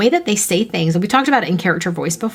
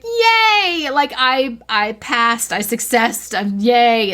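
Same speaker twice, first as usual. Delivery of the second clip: high, drawn-out yay; gleeful cheer; 6 semitones higher, much slower than usual. Retold or imitated. Writer when a reader knows her character, gleeful cheer. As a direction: cheer it high like you just won. Acting out delight at reader's recognition.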